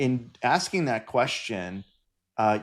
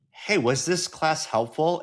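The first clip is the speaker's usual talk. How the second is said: leading question; 6 semitones higher, slower than usual. Retold or imitated. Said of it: "nod my head up and down as I ask it".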